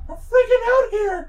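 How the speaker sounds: slow, loud, very high squeal; mock panic. Player echoing co-player's freak-out, mock panic. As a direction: wail it in hammed-up terror.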